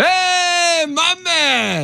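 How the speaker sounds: excited cheer. Real, not acted. Celebrating his success.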